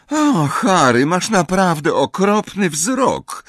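high, drawn-out sighing complaint; sighing Hermione. A narrator's reading. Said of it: "westchnęła Hermiona".

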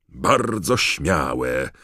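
loud, slow, drawn-out exclamation; commentator's emphatic approval; Bagman calling out. A narrator's reading. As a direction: call it out slowly to the stands. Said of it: "zawołał Bagman".

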